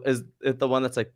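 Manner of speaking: ordinary talk, nothing marked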